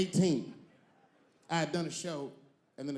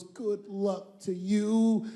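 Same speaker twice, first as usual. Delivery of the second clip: imitating Nicole; 5 semitones higher, much slower than usual. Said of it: "She goes".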